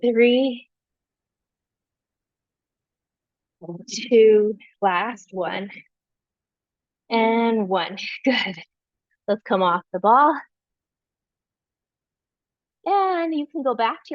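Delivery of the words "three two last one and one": strained counting while unsteady; balancing on one leg. Real, not acted. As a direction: push the count out while wobbling. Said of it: "Oh, I'm losing my balance".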